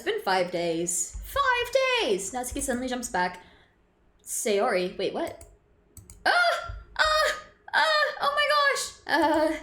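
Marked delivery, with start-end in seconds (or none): 1.2-2.3 much higher, drawn-out cry of disbelief; shocked exclamation. Real, not acted. Streamer reacting to five days, shocked exclamation. 6.3-8.9 short, very high cries of surprise; high startled squeals. Real, not acted. Streamer startled by the game, high squeals.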